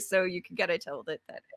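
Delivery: usual speaking voice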